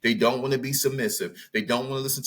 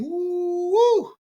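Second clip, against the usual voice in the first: mock whoop; 5 dB louder than usual. Whooping after the acted reply.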